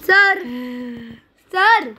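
calling out. Acted. Bodyguard calling his boss about dinner, loud call. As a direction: call across the house for your boss.

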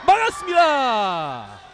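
loud, slow stretched shout; drawn-out save call. Real, not acted.

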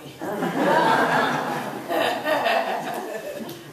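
big hearty laugh; laughing out loud at the punchline; loud laughter. Real, not acted. Congregation laughs at joke.

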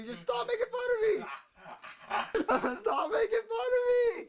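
high, wailing sob; crying. Acted. Bald roommate begs them to stop teasing, wailing sobs. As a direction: break down bawling. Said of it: "he'll start crying".

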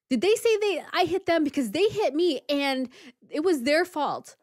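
high, loud agitated self-defence; hammed-up indignant rambling; frantic protesting. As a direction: mimic someone wildly blaming others. Imitating crazy guy arguing with sheriff.

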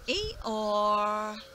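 dry donkey bray. Acted. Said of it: "the donkey just looked up at him and went".

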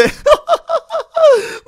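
high giggling burst; sudden high laugh; burst of laughter. Real, not acted. Laughing at friend's rocket fail.

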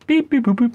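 high loud snorting boops; pig oinks. Shown as a demonstration. Pig noise unlike Peppa Pig. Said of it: "somebody in there".

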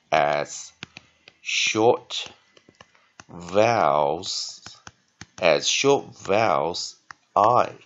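loud, slow, word by word; emphatic term reading. Shown as a demonstration. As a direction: spell out the rule with pauses between words. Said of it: "variant E".

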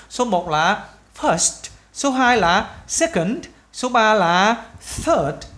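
each word drawn out slowly for dictation; slow teaching read. Shown as a demonstration. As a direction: spell it out slowly for the class. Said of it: "các bạn nhớ chưa". (đọc chậm, rõ từng chữ cho học sinh)

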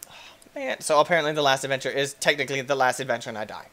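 ordinary talk

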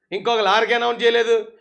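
ordinary delivery

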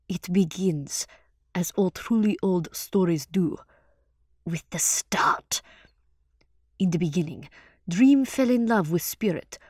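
slow, hushed character storytelling; spellbinding fireside tale-teller; storyteller's legend. Acted. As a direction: begin an ancient myth with weighty pauses.

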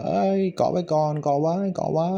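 urgent, high-pitched hero shout; Spider-Man. Acted. Teacher acting out a hero's line in Thai, urgent high call. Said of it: "ที่ Spider-Man มันพูดว่า".

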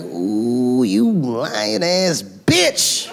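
high, drawn-out outraged cry; furious outburst. Retold or imitated. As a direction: stretch it slowly upward in mock outrage. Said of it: "It was furious".

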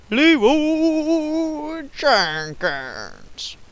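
very slow, high drawn-out battle cry; Leroy Jenkins cry. Pretend. Gamer charging in with the Leroy Jenkins meme, drawn-out high yell. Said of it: "Let's, let's do this".

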